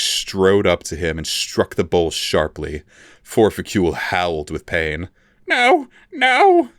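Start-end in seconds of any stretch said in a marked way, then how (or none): 5.5-6.7 much higher, louder pained whimper. A narrator's reading. The struck elf whimpers to his torturer, high and pained. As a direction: cry out in pain. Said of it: "he whimpered".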